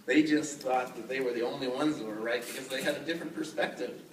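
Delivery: ordinary delivery, nothing marked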